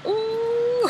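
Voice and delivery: high "ooh" swelling into a whoop; excited squeal. Acted. Recapper reacting as real nice Nate walks up, excited squeal. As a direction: squeal upward in mock swooning excitement.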